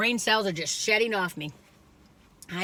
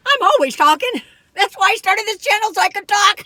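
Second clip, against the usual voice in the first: high, louder, bubbly self-mockery; playful exclaiming; 10 semitones higher, 8 dB louder than usual. Real, not acted. Joking to viewers about always talking, high and louder. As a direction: burst out as if confessing proudly.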